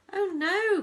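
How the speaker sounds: high, loud cry of dismay; alarmed cry. A narrator's reading. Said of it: "cried Missus T V".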